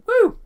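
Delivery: very high, loud "woo"; short piercing cheer; excited whoop. Real, not acted. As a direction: whoop it up for a favourite show. Cheering for favourite Trek series.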